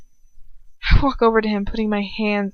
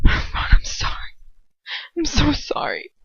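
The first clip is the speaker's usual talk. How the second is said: tearful apology; 6 semitones higher than usual. A narrator's reading. Tearful apology after a sob.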